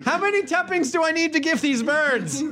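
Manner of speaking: put-on high little-kid question; whiny child. Child asking about the lullaby, high kid voice. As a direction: ask it in a high childish pitch.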